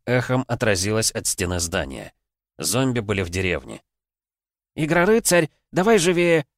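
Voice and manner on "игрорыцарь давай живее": urgent high shout across the yard. Acted. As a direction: yell to hurry him up. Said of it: "крикнула охотница". (громкий крик)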